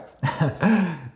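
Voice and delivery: a short laugh through rising tears; teary laugh. Real, not acted. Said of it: "I'm about to cry".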